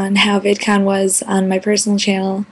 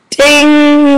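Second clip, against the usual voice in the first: mock ding; 5 semitones higher, 4 dB louder than usual. Announcing the intro with a sound.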